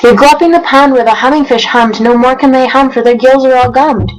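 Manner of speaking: rhythmic read-aloud of rhyming verse; storybook narration. A narrator's reading. Reading Dr. Seuss to viewers, lively storybook narration. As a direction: read it like a bedtime picture book.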